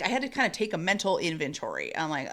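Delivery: ordinary talk, nothing marked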